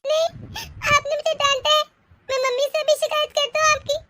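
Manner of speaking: high, tearful tattling; crying child. Acted. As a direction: wail for mummy like a hurt little kid. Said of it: "तू इस तरह रो क्यों रहा है". (रोते बच्चे की तरह शिकायत)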